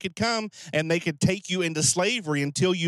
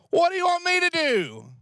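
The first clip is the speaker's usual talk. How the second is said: very high mock-exasperated question, falling at the end; uncaring, exasperated; 12 semitones higher, 3 dB louder than usual. Prophet wrongly read as fed up with a pleading widow, uncaring. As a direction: throw it back as an uncaring complaint. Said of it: "That sounds very uncaring".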